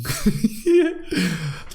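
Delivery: short laugh. Real, not acted. Laughing at ghost beard.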